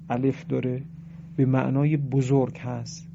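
ordinary delivery

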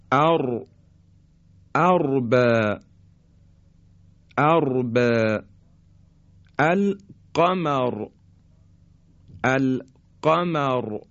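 ار، ارب، القمر with a thick, full R; heavy ra. Shown as a demonstration. Teacher showing silent ra after fatha, heavy in ارب and القمر. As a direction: recite القمر slowly, closing on a heavy ra.

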